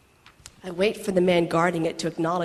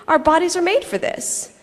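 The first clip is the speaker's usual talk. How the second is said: normal talking voice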